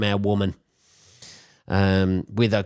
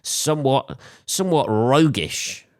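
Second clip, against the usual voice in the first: posh quoting voice; 6 semitones higher than usual. Retold or imitated. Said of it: "as they would say".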